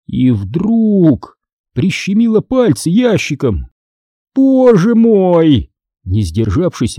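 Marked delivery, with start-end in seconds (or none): 4.3-5.7 high, drawn-out cry of pain; pained squeal. A narrator's reading. Teacher catching her fingers in the drawer, squealing in pain. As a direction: squeal it slowly, as if hurt. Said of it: "она взвизгнула от боли".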